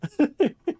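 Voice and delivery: laughing. Real, not acted. Laughs after teasing.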